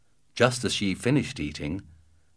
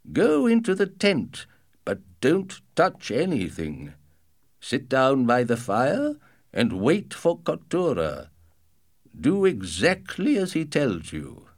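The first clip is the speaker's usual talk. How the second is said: father voice; 5 semitones higher than usual. Acted. Father instructs.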